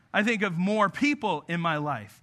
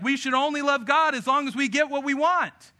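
a lightly voiced, higher nagging wife; mock the wife, pitched up a little; Job's wife voice; 5 semitones higher than usual. Voicing Job's wife in retelling.

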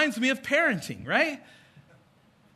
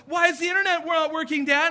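put-on whiny kid question; 6 semitones higher than usual. Kids come asking dad.

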